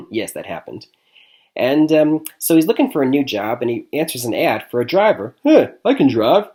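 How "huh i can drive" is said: put-on loud, high Italian-American tough-guy accent. To the client, Tony in a loud Italian-American accent. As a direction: do Tony's Italian-American accent, a bit louder. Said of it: "in his Italian accent".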